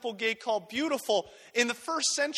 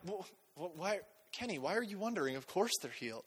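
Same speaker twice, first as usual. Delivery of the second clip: hushed, softer scoffing at the crowd; mock dismissive; 4 semitones lower, 12 dB quieter than usual. Preacher mocking a know-it-all believer, soft and dismissive. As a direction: brush off their wonder with a quiet sneer. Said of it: "I wouldn't be the guy that's like".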